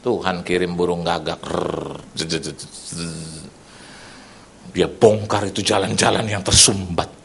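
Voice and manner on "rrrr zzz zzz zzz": very low, soft, slow rumbling buzz; low growling buzz. Retold or imitated. Preacher imitating the crow clearing the blocked roads, low buzzing. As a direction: rumble it out like a low engine noise.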